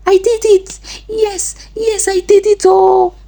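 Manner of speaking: high-pitched, agitated outpouring; mild shouted confession. A narrator's reading. Chisom on the ground confessing to Amaka, agitated cry. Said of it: "started shouting, shaking and confessing".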